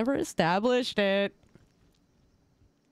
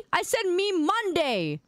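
steady, slow, a bit raised; firm correction; 7 semitones higher than usual. Acted. Streamer correcting chat, slow and firm. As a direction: set the record straight, slowly. Correcting a misread meme caption.

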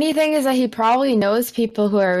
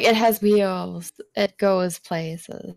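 slow, spaced-out words; mock-simple explanation, drawn out; clueless slow answer; 4 semitones lower, much slower than usual. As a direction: spell out the obvious very slowly. Acts out own clueless answer about cars.

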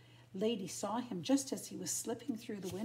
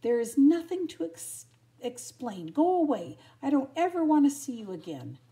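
loud, high, furious dismissal; indignant storybook outburst; angry rejection; 6 semitones higher, 11 dB louder than usual. A narrator's reading. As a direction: send him away for good. Acted quarrel, Lady rejects Tramp.

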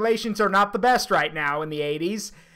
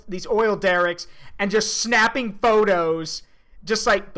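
ordinary delivery

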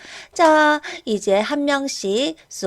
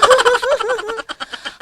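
high mocking laugh; 10 semitones higher, 6 dB louder than usual. Mocking teacher.

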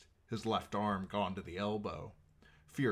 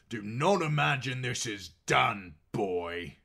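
loud, raised-pitch warning from a defiant captive; stern warning; 4 semitones higher, 6 dB louder than usual. A narrator's reading. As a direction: warn him firmly and loudly. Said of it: "warned one".

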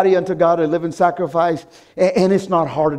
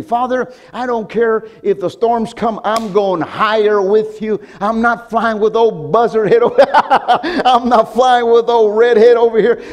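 bold mock prayer; 5 semitones higher, 5 dB louder, slower than usual.

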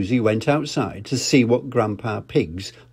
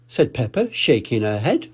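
ordinary delivery, nothing marked

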